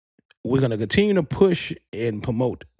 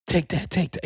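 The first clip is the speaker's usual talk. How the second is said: much higher, hyped encouraging voice; 6 semitones higher than usual. Retold or imitated.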